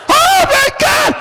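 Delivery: very high, drawn-out mock scream; high-pitched comic screaming; imitated screaming. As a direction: shriek it like a horrified man fleeing a stench. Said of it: "is screaming".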